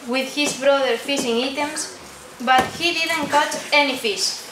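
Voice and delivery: plain reading voice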